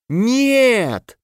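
high despairing cry; anguished wail. Acted. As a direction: wail it in dismay. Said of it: "послышался вопль Альта".

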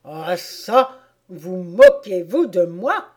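loud, slow, outraged King; indignant royal outburst; indignant exclamation. Acted. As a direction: exclaim as if mocked. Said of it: "s'écria le roi".

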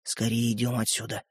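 low, very soft secret murmur; whispering. A narrator's reading. Brother confiding he has Чик, whispering. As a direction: breathe it through barely moving lips. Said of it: "едва шевеля губами прошепел тот".

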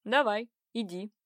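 slow, menacing dare; threatening. A narrator's reading. Angry man calling the girl's bluff, threatening. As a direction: dare her to go with a touch of threat. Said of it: "угрожающе выпалил".

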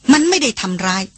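usual speaking voice

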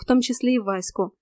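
usual speaking voice

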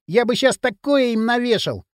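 high-pitched frustrated whine; moaning complaint. A narrator's reading. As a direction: grumble over the missed fight. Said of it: "простонал Бивень". (высоко, с досадой)

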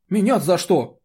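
loud, high-pitched protest; indignant. A narrator's reading. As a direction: object as if unjustly accused. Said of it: "возмутился Ид".

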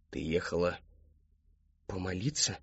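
low, soft, halting question; quiet questioning. A narrator's reading. Father questioning his daughter's story, low and quiet. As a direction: ask softly with a long pause.

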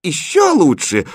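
high, loud cry of delight; delighted exclamation. Acted. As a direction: exclaim like a thrilled princess. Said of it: "воскликнула принцесса". (восторженно восклицая)

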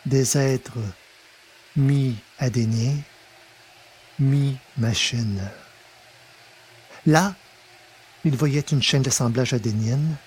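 usual speaking voice

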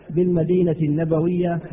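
ordinary talk, nothing marked

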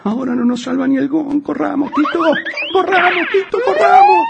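very high, shrill rising panic yell; panicked screaming. Acted. Fleeing in panic.

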